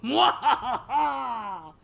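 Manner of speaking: slow, loud villain laugh; evil cackle. Pretend. Villainous laugh over the notes.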